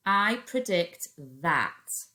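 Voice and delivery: I predict that drawn out slowly; teacher dictates while writing; slow dictation. Shown as a demonstration. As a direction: say I predict that slowly as you write. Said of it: "Three Fred fingers for that".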